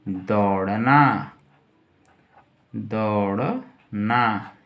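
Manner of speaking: दौड़ना held long and slow, falling; slow drawn-out word. Shown as a demonstration. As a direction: stretch दौड़ना for the class to write. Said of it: "teacher stretches meaning twice". (दौड़ना को धीरे खींचकर बोलें)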